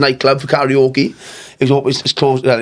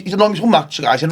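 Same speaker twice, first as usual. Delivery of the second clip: ordinary talk, nothing marked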